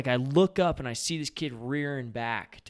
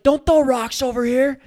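loud high warning shout; shouting at kids; 12 semitones higher, 8 dB louder than usual. Retold or imitated. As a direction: call up the hill to stop them. Yelling at kids throwing rocks.

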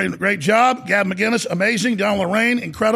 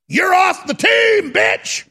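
mock angry coach; 11 semitones higher, 3 dB louder, much slower than usual. Coach firing rider, acted quote.

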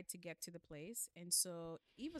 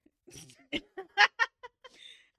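loud laugh; 15 dB louder than usual. Real, not acted. Hosts crack up at jargon.

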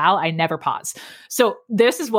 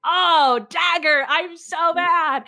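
mock frustration; 7 semitones higher, much slower than usual. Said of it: "I'm like".

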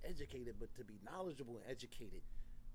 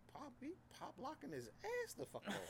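impressed onlooker; 11 semitones higher, 6 dB quieter than usual. Retold or imitated. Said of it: "Everybody was looking at me like".

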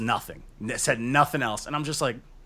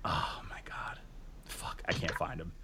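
unvoiced frantic whisper; 9 semitones lower, 14 dB quieter than usual. Real, not acted. Hunting for the kid's message, whispering to himself. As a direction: whisper it under your breath while searching. Searching messages under breath.

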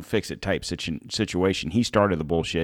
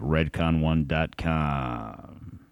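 drawn-out plug; 4 semitones lower, 3 dB quieter, much slower than usual. Acted. Sponsor plug repeated.

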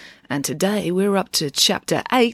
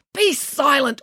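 high, firm command; teacher's raised-pitch order; 9 semitones higher, slower than usual. Acted. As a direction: order silence sharply. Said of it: "ordered Mister Lang".

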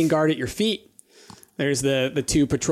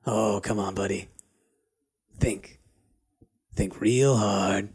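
lightly voiced sneaky lizard folk; sly thief voice; 5 semitones lower than usual.

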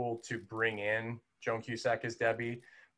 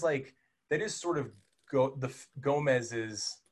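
ordinary talk, nothing marked

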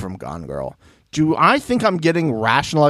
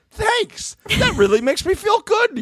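much higher, sugary mock gratitude that sours into an insult; fake-sweet thanks. Thanking a gross admirer with a sugary, mocking voice. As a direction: gush thanks, then slip the insult in.

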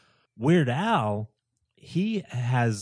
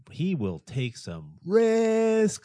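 slow emphasis; 10 semitones higher, 3 dB louder, much slower than usual. Real, not acted.

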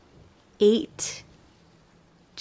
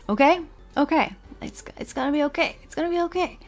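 higher, lightly hammed self-reassurance; a touch of nervous comforting; mock self-soothing; 7 semitones higher, faster than usual. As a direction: talk yourself down, half joking. Soothing herself after listing defences.

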